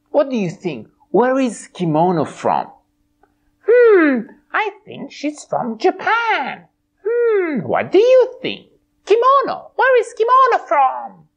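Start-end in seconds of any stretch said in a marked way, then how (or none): 3.7-11.3 high bright pony voice; a cheerful high puppet pony. Acted. Twilight puppet answering host, high pony voice.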